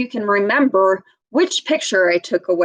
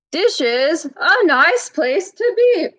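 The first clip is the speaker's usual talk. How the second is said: lightly voiced punchline, rising; a hint of a playful reader; joke punchline voice; 7 semitones higher than usual. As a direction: deliver the punchline with a lift.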